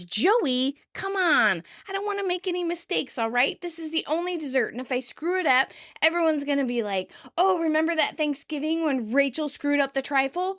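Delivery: fretful telling-off, lightly acted; Rachel. Rachel admonishes Joey over the only dessert, fretful. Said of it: "And Rachel admonishes".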